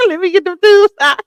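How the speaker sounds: high, loud, quick laughing; words bursting out through laughter. Real, not acted. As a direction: crack up while talking. Cracks up at own joke.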